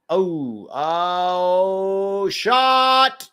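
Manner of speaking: drawn-out rising "oh" into a shout; excited goal call. Real, not acted. Commentator reacting live to a shot, excited shout. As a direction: build up to a loud, high cry.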